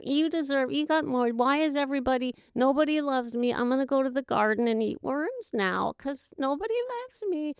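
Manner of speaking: high, whiny, self-pitying sulk. Retold or imitated. Mocking the self-pitying voice of dissatisfaction, high and whiny. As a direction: whine like a sulking child. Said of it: "a whiny, self-pitying voice".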